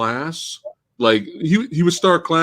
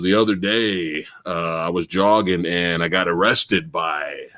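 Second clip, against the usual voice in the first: teacher imitation; 6 semitones lower than usual. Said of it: "And he goes".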